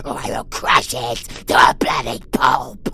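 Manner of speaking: loud, fast menacing threat; loud fast villain threat; threatening shout. Acted. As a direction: bark the threat.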